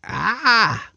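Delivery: sudden high scream of fright; startled yell. Acted. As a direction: yelp in shock. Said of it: "and yelled".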